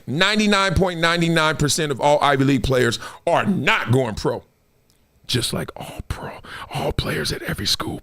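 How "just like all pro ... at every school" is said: a quick hushed confession; whispered aside. Real, not acted. Admitting to listeners the truth, whispered aside. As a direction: whisper it under your breath.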